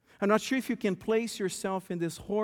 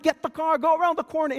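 higher and louder instructions; mild mock yell; 8 semitones higher, 5 dB louder, faster than usual. Wesley telling his wife to get the car, yelling. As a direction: call the orders while running. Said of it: "still yelling instructions".

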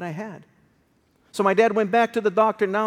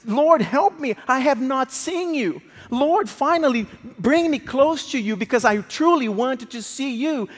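raised, louder plea to the Lord; retold crying out; 6 semitones higher, 4 dB louder than usual. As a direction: cry out to God, pleading. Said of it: "my dad started screaming".

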